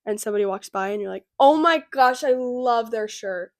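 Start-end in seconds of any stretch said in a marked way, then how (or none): 1.4-3.5 excited loud compliment; loud gush. Retold or imitated. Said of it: "So loud".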